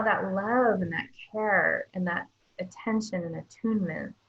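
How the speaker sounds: ordinary talk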